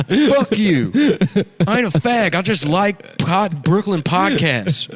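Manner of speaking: loud, high furious denial; angry cowboy. Acted. Cowboy shouting at doctors, loud and high. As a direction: yell it like a cornered cowboy. Cowboy angrily denying in scene.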